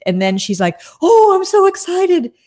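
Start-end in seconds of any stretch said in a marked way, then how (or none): 1.0-2.3 much higher, slow, gushing mock reporter; hammed-up fake excitement. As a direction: overdo a fake thrilled gush. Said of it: "It was so fake".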